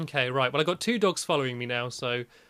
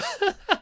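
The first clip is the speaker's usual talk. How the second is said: shaky laugh. Real, not acted. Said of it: "I'm, I'm shaken up".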